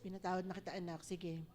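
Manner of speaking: low, soft and gentle, like a loving father; tender voice of God. Acted. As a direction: speak forgiveness softly to a child. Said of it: "sabi ni Lord". (malambing at mahinang tinig ng Diyos)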